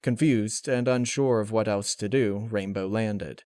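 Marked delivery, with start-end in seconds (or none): none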